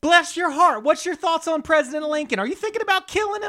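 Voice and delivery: put-on very high, sweet Southern belle accent, speeding up; sugary, very high Southern belle accent, rushing the question; Southern lady. Spy asking a local about killing Lincoln in a very high Southern belle accent. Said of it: "She's pretending to be a Southern lady".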